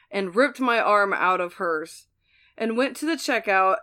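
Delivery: ordinary delivery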